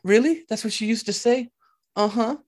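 high-pitched, wide-eyed reply; acted comforted girl. Upset host answering the exchange student, high acted voice. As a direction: play her taking it in, pitched well up.

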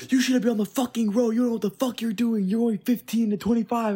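high, loud mock scolding; angry driver. Storyteller imitating the old driver cussing them out near the school, high angry scolding.

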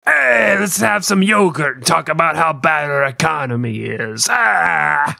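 boisterous put-on Greek accent; mock Greek. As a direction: call out as a cartoon Greek. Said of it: "it's actually Greek".

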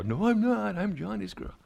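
put-on high little-girl voice, insisting; little girl protesting. Daughter insisting to her dad, high and indignant. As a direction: pipe up like a stubborn three-year-old.

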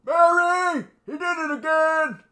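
high, loud call for help. Pretend. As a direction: holler for Mary in mock exasperation. Calling out to Mary.

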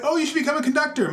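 high, quick encouraging voice; friends quote. Retold or imitated. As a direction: chirp it high and fast.

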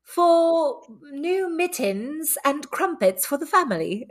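slow, high British commercial read; stretched-out, hammed-up British ad voice; British accent. Theater major improvising a Kohl's ad, British accent. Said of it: "do a commercial for Kohl's".

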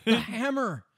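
high-pitched mock cry; excitable character voice. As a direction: call it out high and hammy. Acting out excitable in-joke character.

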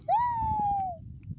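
very high thin sniffing hum; squeaky drawn-out inhaling sound; imitated baby sniffing. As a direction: mimic a toddler's long eager sniff. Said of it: "Ela faz".

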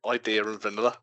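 "Idea" linked by an R into "and", "vanilla" ending in UH; British linking R. Shown as a demonstration. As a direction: slip an R between "Idea" and "and". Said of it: "that's how we say it".

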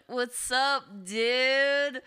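high, stretched surfer-dude Californian; mock Californian accent. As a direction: put on an exaggerated California accent. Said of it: "sound like someone from California".

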